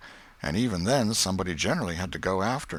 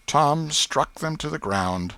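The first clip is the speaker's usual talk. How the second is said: ordinary delivery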